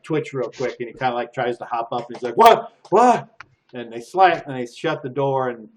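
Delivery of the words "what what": high, loud startled yelps; startled prisoner. Acted. Startled prisoner jumps up, loud and alarmed. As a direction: jolt awake in alarm.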